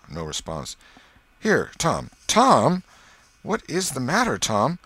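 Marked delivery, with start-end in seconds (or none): 1.4-4.8 loud, high, worried calling; urgent and worried, trying to rouse him; anxious. Acted. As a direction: call out in rising alarm. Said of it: "looked in his face anxiously".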